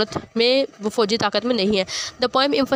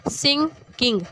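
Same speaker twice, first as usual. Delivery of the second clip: "Singh king" as two higher, separate beats; split into syllables; 4 semitones higher than usual. Shown as a demonstration. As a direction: break "Singh king" in the middle. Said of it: "बोलते हुए जब आपको लगे कि word के दो हिस्से". ("सिंग king" दो हिस्सों में)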